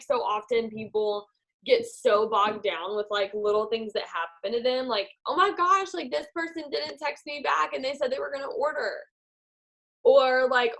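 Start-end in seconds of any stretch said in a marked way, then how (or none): 5.3-9.0 higher-pitched fretting distributor; mock dismay. Distributor complaining about a customer who vanished, mock dismay. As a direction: play up a small setback as a crisis. Voices a bogged-down, upset distributor.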